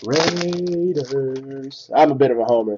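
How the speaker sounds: ordinary talk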